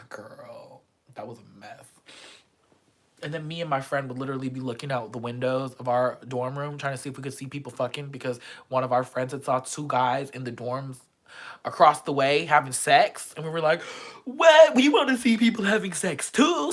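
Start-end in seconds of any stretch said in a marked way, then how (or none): 0.1-1.8 soft unvoiced whisper. Real, not acted. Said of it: "whispered embarrassed aside". 14.2-16.7 high, loud excited squeal; excited shriek. Retold or imitated. Excited outburst retold.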